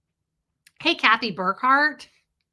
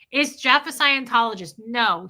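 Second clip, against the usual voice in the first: ordinary delivery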